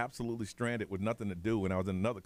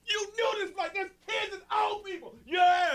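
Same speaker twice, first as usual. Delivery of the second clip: angry passenger; 4 dB louder than usual. Retold or imitated. Angry mob yelling at agents.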